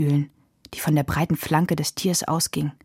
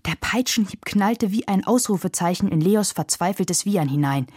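plain reading voice

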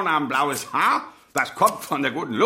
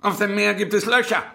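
a sudden high, loud "Na"; delighted prod; 7 semitones higher, 4 dB louder than usual. Acted. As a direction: burst out with glee.

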